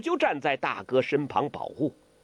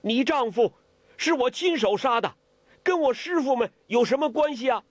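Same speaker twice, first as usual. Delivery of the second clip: high-pitched, urgent protest; crying out; 10 semitones higher than usual. A narrator's reading. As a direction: call out high, defending your masters. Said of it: "郭靖叫起来了". (高声叫喊着争辩)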